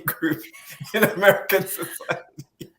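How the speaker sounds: laughing mid-sentence; can't get words out for laughing. Real, not acted. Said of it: "You can't even say it without laughing".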